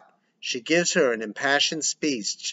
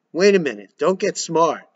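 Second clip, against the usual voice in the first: stern warning; 4 semitones higher, 6 dB louder than usual. Acted. Father warns son.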